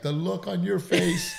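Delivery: high voice cracking up with laughter; laughing. Retold or imitated. Friend laughing at the comic's shocked face. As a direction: laugh through the line at the joke. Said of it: "busted out laughing 'cause he's like".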